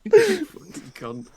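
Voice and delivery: slow, broken up by real laughter; barely getting words out for laughing; laughing insult. Real, not acted. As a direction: curse a mate through helpless laughter. Laughing at friend's teasing.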